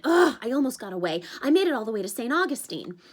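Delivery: groaning at the bad luck; exasperated satyr.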